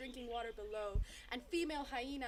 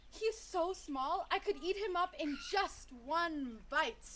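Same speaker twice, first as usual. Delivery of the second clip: slow, loud, higher-pitched taunt; jeering; 5 semitones higher, 5 dB louder, slower than usual. A narrator's reading. Wild dog taunts the small sheepdog, jeering. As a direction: draw out the boast mockingly. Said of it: "one wild dog jeered".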